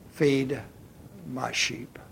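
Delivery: gentle, softening command with a pause; very quiet. Acted. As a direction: say it quietly, letting it fall away. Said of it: "Jesus very quietly said".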